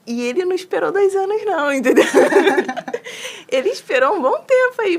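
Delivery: a high, loud burst of laughter through the words; laughing. Real, not acted. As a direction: laugh out loud while making the point. Laughing at his own point.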